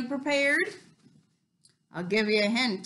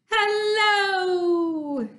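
big loud higher hello; 7 semitones higher, 5 dB louder than usual. Shown as a demonstration. Host shouts hello as loud as she can. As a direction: call it out loud and bright. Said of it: "as loud as we can".

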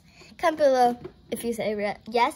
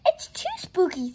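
higher, spooked character voice; a scared little complaint; shivery fear; 7 semitones higher than usual. A narrator's reading. Jojo tells friends it's spooky, higher fearful voice. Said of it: "said Jojo with a shiver".

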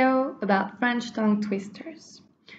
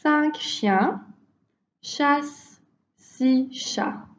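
slow, careful teacher demo; 4 semitones higher, slower than usual. Shown as a demonstration. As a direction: carefully, split into two chunks. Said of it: "repeat after me".

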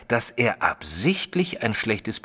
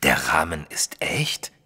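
hushed, slow, stunned whisper; astonished whisper; 5 semitones lower than usual. Acted. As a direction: breathe the question in disbelief. Astonished question about the frame.

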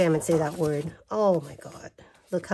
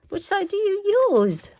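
delighted dilemma; 12 semitones higher, 4 dB louder than usual. Real, not acted. Torn between two sides.

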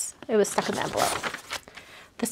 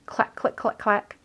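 mock typing sounds. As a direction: tap out the clicks lightly like keys. Mimicking typewriter sound on card.

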